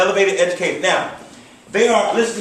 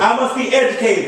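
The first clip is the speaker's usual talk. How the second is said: raised, rallying pitch; with more passion; 4 semitones higher than usual. Shown as a demonstration. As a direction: lead the chant with excitement. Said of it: "I don't feel you yet".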